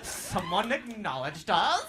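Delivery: slow, high mock squeal; group of women. Comic mimics women pleased at attention, drawn-out mock excitement. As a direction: draw it out, imitating thrilled women.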